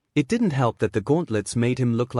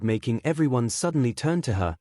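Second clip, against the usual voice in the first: plain reading voice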